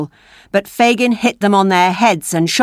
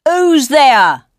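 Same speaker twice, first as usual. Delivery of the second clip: louder call into the dark; cried out; 6 semitones higher, 5 dB louder than usual. A narrator's reading. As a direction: call out warily. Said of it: "a voice cried out".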